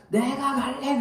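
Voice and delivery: high, whiny loud protest; child carried out protesting loudly; child's protest. Retold or imitated. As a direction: kick up a fuss.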